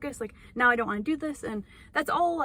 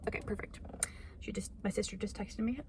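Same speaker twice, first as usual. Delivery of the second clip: whispering; 11 dB quieter than usual. Real, not acted. Reacting to sister's text, whispered.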